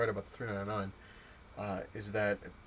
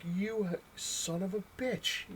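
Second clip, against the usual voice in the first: slow, high, drawn-out mock curse; 10 semitones higher, much slower than usual. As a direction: stretch out the insult in comic outrage. Cursing Marvel over eight dollars.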